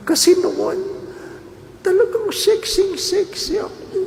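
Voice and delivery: high, airy mock woman's voice; coy woman friend. Friend boasting to the Father, in a high coy mock voice.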